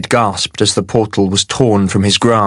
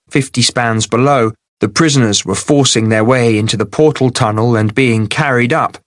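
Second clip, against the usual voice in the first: ordinary delivery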